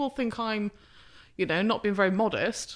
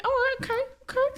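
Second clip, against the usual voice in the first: high-pitched. Retold or imitated. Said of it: "It's like".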